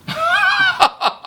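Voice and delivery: shrieking laugh; loud high laugh. Real, not acted. Laughing at the joke.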